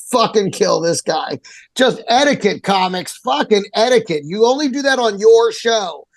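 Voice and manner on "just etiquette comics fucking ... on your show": usual speaking voice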